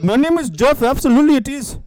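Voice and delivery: high, loud silly caller voice; caller Jeff. Acted. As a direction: pipe up loudly as the returning caller. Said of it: "Who is this?".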